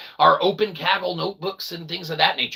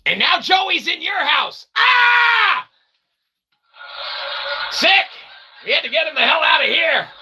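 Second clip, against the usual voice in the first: mock horror; 11 semitones higher than usual. Streamer hamming up fright at Joey in the house, yelling. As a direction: shriek as if he just burst in.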